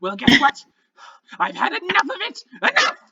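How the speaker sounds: high, scornful goblin voice; goblin necromancer. Acted. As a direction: sneer like an offended little goblin. GM holds the goblin voice.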